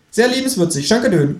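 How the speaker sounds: very high kangaroo character; cheeky kangaroo voice. A narrator's reading. Kangaroo thanking the narrator with wordplay, high cartoon voice.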